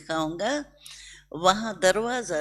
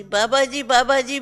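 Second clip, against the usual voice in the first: imitating devotees; 5 semitones higher, 5 dB louder than usual. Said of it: "भी कहते रहे".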